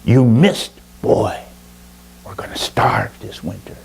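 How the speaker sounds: bitter grandmother, fading to a whisper. A narrator's reading. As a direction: spit it out bitterly, then drop to a whisper. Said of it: "in a very bitter, bitter voice".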